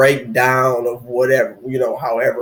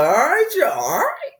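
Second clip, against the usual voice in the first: playful high hype voice; mock rapper voice. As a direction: lean back and hype it up. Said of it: "He's sitting there like".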